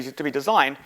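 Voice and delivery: normal talking voice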